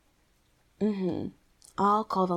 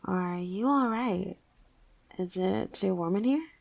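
ordinary talk

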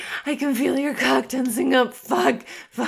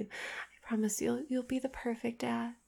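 whisper; 2 semitones lower, 11 dB quieter than usual. Acted. Intimate promise.

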